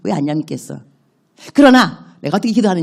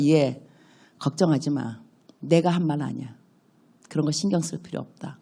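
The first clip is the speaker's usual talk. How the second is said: soft reassuring God; 4 semitones lower, 7 dB quieter than usual. Retold or imitated. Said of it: "그러면 주님께서".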